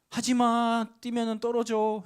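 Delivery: alarmed parent shouting; retold shout. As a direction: yell at the kids on the bed. Father yelling at jumping kids.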